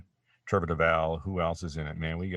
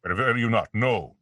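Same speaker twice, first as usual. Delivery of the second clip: lightly Shakespearean villain, stretched-out no; Megatron; 3 semitones higher, 4 dB louder than usual. Shown as a demonstration. Actor showing his Megatron ad-lib, Shakespearean grandeur. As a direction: declaim it like a stage tragedian. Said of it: "it's like Shakespeare".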